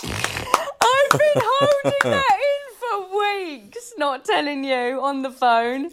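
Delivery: high, loud, drawn-out elation; bursting with news. Real, not acted. Friend finally sharing news held in for weeks, loud elation. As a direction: let pent-up excitement out loudly.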